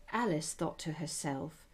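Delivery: ordinary delivery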